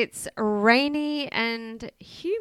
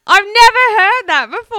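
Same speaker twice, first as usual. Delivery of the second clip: higher and louder, delighted disbelief; amazed exclaim; 9 semitones higher, 11 dB louder than usual. Real, not acted. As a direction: gush that it's brand new. Amazed at new slang.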